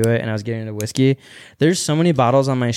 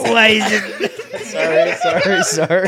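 higher, laughing sorries; can't stop laughing while apologising; laughing apology; 8 semitones higher, slower than usual. Real, not acted. Guest laughs at the mishap, apologising through laughter.

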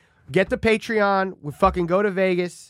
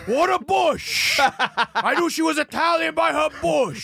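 mock Italian accent; 8 semitones higher, slower than usual. Joins the mock Italian bit.

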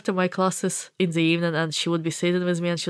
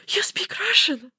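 breathless, much higher, unvoiced rush; eager excited question; 12 semitones higher than usual. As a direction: rush up to strangers, thrilled. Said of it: "I just ran to them".

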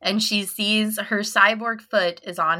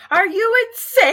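high, incredulous mock shout; hammed-up outraged yell; 12 semitones higher, 4 dB louder than usual. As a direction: yell it in high-pitched disbelief. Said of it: "he just yells".

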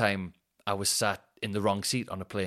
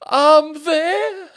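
much higher, louder squeaky whine; mock whiny passenger; 12 dB louder than usual. Mock whine, passenger claiming his plane seat. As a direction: whine it like a wronged little man.

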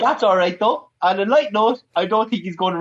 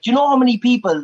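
loud, higher mimicked accent; Conor McGregor; 6 semitones higher, 5 dB louder, faster than usual. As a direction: imitate the ranting caller's accent. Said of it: "why am I even doing this accent".

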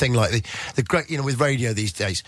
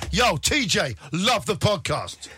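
cool playa greeting; 8 semitones higher, slower than usual. Retold or imitated. Rich players greet him.

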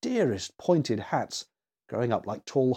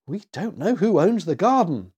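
put-on higher-pitched worried child; mildly acted fright, pitched up; frightened; 5 semitones higher, 4 dB louder than usual. A narrator's reading. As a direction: voice a scared little girl. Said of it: "gasped poor Annie in fright".

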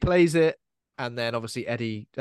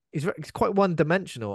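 normal talking voice

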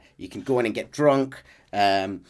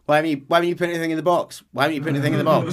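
nagging Dutch; 4 semitones higher, 4 dB louder, much faster than usual. Retold or imitated. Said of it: "Dutch will be following him around going".

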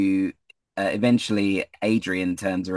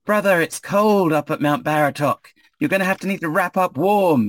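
high-pitched calling out; raised, carrying voice, high and slightly louder; shout from behind; 9 semitones higher, 5 dB louder than usual. Acted. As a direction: call a warning up to a friend ahead. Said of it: "you hear a shout coming from behind you".

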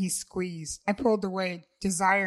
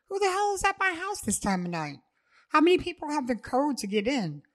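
alarmed; 8 semitones higher, faster than usual. Acted. Alarmed inner questions.

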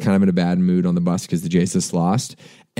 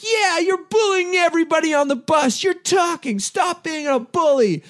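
put-on much higher, whiny girl's voice; girls scolding. Girls behind him scolding the drunk guys, whiny mimicry. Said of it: "you hear these girls sort of behind me".

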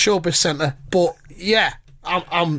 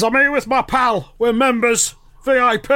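a hint of a higher, scolding old man; lightly voiced Corbyn; 6 semitones higher than usual. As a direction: tease him like a nosy neighbour's scolder.

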